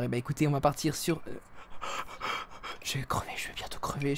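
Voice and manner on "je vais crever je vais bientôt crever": fast, voiceless whisper. Real, not acted. Streamer confiding to dear friends they will die, hurried whisper. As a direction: whisper it quickly under your breath. Confides to viewers under breath.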